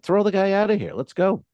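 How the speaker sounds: high-pitched retold demand; acting out an indignant ejection order. Comic retelling wanting a heckler removed, indignant.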